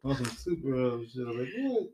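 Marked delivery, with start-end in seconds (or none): 1.6-1.9 surprised. Real, not acted. Co-host's shocked reaction.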